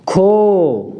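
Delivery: खो said as aspirated "kho"; teacher sounding out "खो"; kha with o matra. Shown as a demonstration. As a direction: model "खो" clearly. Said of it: "अगर ख पे लगा दी तो".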